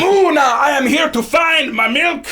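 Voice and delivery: put-on slow, shouted minotaur demand in a French accent; Muna, French accent. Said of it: "Muna also has a French accent".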